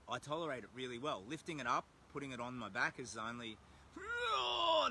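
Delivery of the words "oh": sudden high strained grunt while hoisting the pack; effortful grunt. Real, not acted. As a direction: heave the heavy pack up with a yelp. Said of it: "I need a little bit of swearing".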